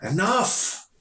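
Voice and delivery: high, sharp outburst; anguished cry. A narrator's reading. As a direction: cry out to silence the noise. Arson cries out in panic.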